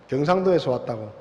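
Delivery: usual speaking voice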